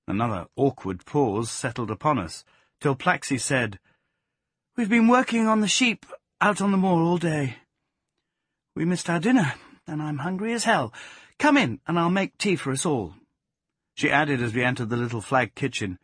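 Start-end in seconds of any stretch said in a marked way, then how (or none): 4.7-13.1 high, lively woman's character voice; young woman character, chatty. A narrator's reading. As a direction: voice her brightly, pitched well up.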